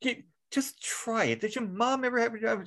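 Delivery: lightly voiced wife scolding; exasperated wife. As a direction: needle him like a fed-up wife. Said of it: "she goes".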